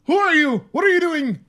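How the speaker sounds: high, loud mock shriek of alarm; hammed-up scared scream at the intruder; startled shriek. Wife shrieks at the leprechaun bursting from the suitcase, high and loud.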